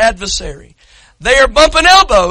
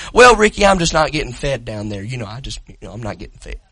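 mocking excuse; 12 semitones lower, 3 dB quieter, faster than usual. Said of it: "they'll say".

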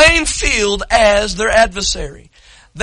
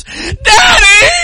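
wailing, much higher and louder "Daddy!"; a hurt child crying out for her father; father imitating his spanked daughter crying; real crying call; 10 semitones higher, 6 dB louder than usual. Shown as a demonstration. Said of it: "because it sounds like this".

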